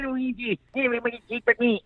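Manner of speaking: a jittery little brother talking fast; Luigi voice. Acted.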